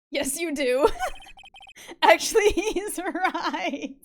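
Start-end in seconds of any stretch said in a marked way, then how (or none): 1.4-3.9 real laughter, pitch jumping higher and louder; can't get the words out for laughing. Real, not acted. Streamer cracks up agreeing with the prosecutor, laughing.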